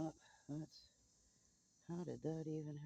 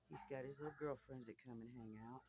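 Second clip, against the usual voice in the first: ordinary delivery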